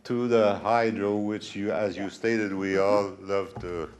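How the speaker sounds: a resident asking his question over the PA, close-miked and loud; into the microphone. Real, not acted. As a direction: speak right up into the mic. Asked to speak into microphone.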